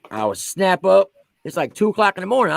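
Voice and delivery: normal talking voice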